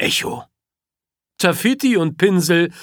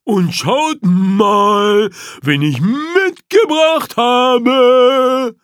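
slow, higher and louder, calm and cheeky; unruffled gnu roaring; 6 semitones higher, 4 dB louder, slower than usual. A narrator's reading. Gnu calmly calling out to King Kofi, slow and louder. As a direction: announce your backup without worry. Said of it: "brüllt das Gnu ungerührt".

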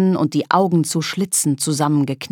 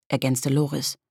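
ordinary delivery

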